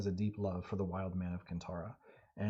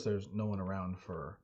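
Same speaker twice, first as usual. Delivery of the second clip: ordinary delivery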